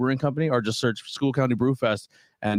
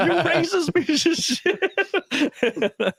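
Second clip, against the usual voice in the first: high, drawn-out accusation through laughter; laughing, hammed-up indignation; mock outrage; much slower than usual. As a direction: imitate an outraged caller, squealing slowly. Caller's punchline, shouted through laughter.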